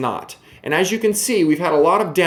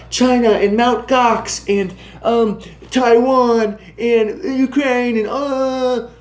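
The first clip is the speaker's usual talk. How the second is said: dismissive mockery; 8 semitones higher, much slower than usual. Real, not acted. Mocking what everyone talks about.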